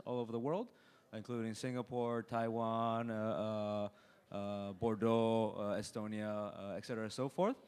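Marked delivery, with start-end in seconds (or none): none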